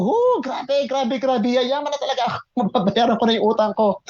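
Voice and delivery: high, giddy squeals with a laugh; giddy excitement. Retold or imitated. Alone in the taxi, squealing with giddy joy. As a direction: rave to yourself about getting rich. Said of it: "talagang parang sabi ko".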